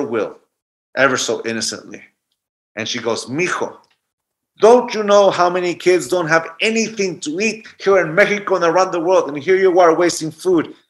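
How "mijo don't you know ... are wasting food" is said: higher, louder scolding grandma; guilt-tripping grandma. Retold or imitated. Grandmother scolding grandson about wasting food, higher and louder. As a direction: shame him into eating.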